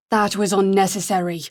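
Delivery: a woman's firm Irish brogue; disapproving, in a strong Irish accent; Irish accent, cool and reproachful. Acted. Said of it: "in a strong Irish accent".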